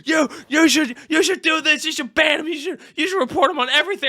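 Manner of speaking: high-pitched frantic mock clamour; imitated crazy chat. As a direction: pile on demands like an outraged chat. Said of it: "the chat was crazy".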